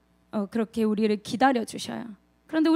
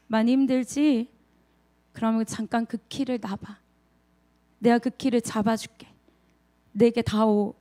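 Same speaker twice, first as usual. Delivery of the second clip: low, unhurried reassurance; gentle comfort; 3 semitones lower than usual. Acted. The Lord to a worn-out believer, calm and tender.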